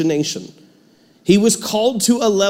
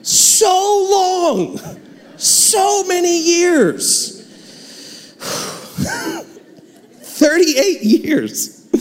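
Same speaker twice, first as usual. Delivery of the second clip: mock lament; 13 semitones higher, much slower than usual. Preacher dramatizes long suffering.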